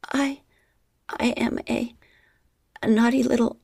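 high, stammering small voice; halting girlish voice. A narrator's reading. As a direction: stumble over it, high.

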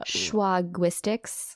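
very slow, drawn-out "Schwagwistics"; slow teasing "Schwagwistics"; playful pun. Pretend. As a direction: savor the pun "Schwagwistics" slowly. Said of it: "maybe some other pun".

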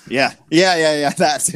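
ordinary talk

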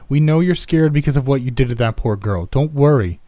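high, loud calling from outside; yelled coaxing. A narrator's reading. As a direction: shout reassurance toward the house. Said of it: "Tracy yelled".

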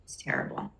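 nearly crying; choked up. Real, not acted. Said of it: "I'm getting blubbery".

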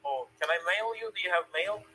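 ordinary talk

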